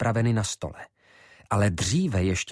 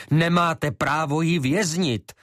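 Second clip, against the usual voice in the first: high, raised protest; calling out; 8 semitones higher than usual. Acted. Ivo protesting to Šafar, raising his voice high. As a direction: call it out as a protest.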